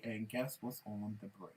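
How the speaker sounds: ordinary talk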